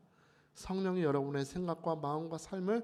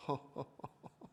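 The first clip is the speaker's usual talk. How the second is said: soft, airy chuckle in imitation; acted old man's chuckle; 7 dB quieter than usual. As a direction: chuckle softly like an amused old pastor. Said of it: "웃으시더니 이렇게 말을 합니다".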